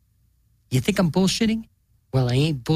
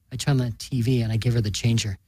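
ordinary delivery

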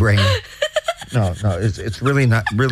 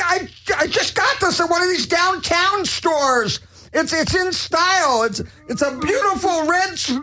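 high whiny complaint; 8 semitones higher than usual. Acted.

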